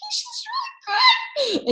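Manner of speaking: high, drawn-out delighted squeal with laughter; retold glee, stretched out and very high; happy. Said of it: "like, happy".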